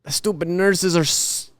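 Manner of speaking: hammed-up politician lamenting his illness, landing on CHINA; Trump. Improvising a cancer scenario for co-host, politician impression. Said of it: "I've been doing my Trump impression".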